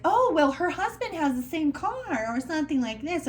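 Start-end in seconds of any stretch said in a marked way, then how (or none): 0.1-2.2 mock excuse.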